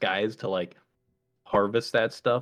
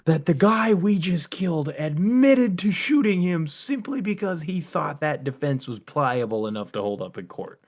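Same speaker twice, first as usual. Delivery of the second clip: incredulous tirade, pitched up and loud, then easing; mock irate boss; 6 semitones higher, 4 dB louder than usual. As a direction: chew out incompetent staff. Acted boss berating the doctors.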